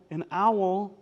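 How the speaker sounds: usual speaking voice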